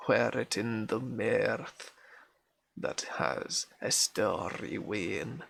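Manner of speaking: nursery rhyme recited in broad Scots; Scots accent. Acted. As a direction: put on a Scottish accent, calling out the lively lines. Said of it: "this is gonna be an accent".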